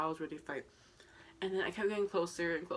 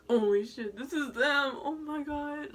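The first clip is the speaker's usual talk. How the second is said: higher, louder, near tears with excitement; overwhelmed excitement; 9 semitones higher, 6 dB louder, slower than usual. Retold or imitated. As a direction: gasp it out like you can't believe they're there. Seeing the band up close.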